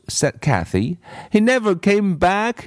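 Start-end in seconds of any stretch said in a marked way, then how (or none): 1.3-2.7 put-on high, insistent little-girl voice; a stubborn girl contradicting her teacher; bratty child insisting she's right; contrary girl.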